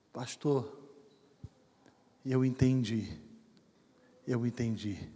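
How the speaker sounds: low, soft, subdued quote of a tearful man; retold tearful voice. Weeping man tells the pastor he understood, retold in mild, hushed sorrow.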